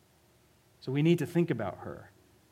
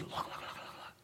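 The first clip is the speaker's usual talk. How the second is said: very soft, unvoiced repeated whisper; urgent whisper; 18 dB quieter than usual. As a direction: whisper to nudge someone's attention. Hushed crowd nudging each other.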